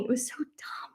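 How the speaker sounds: soft breathy whisper of disbelief; whispered aside. Real, not acted. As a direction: whisper it like a secret complaint. Aside about pointless bureaucracy.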